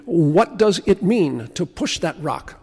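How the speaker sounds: normal talking voice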